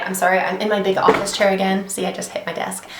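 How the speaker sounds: normal talking voice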